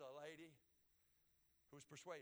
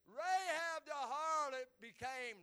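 slow, high, loud build-up; proclaiming preacher; 8 semitones higher, 12 dB louder, much slower than usual. Real, not acted. Preacher starting the killer reveal, drawn-out proclamation. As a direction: draw out the announcement loudly.

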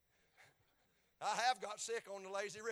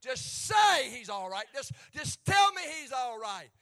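loud, slow shouting plea; shouted plea; 4 semitones higher, 16 dB louder, slower than usual. Acted. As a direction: cry out begging for the servant. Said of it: "the servant said".

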